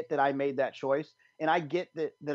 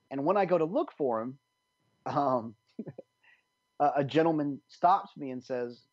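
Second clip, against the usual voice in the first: ordinary talk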